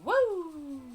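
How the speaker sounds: high, bright little cheer; a quick happy whoop; excited whoop. Real, not acted. Gamer whooping at her own farm.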